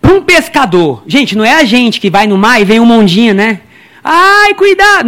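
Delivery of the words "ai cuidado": high squeaky fearful cry; mock scared shriek; mock timid squeal. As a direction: play a frightened landlubber. Mocking a timid sea-goer.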